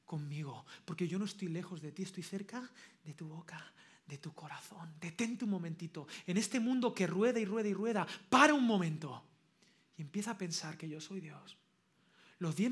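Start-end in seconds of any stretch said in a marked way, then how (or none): 0.0-11.6 Dios. Acted. Da voz a Dios hablando al oyente. (Dios, en voz baja e íntima)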